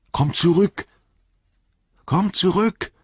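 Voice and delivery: high, pleading call; Rufen aus der Höhe. A narrator's reading. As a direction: shout down to someone lost in the dark. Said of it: "kam Sams Stimme". (hoch und dringlich gerufen)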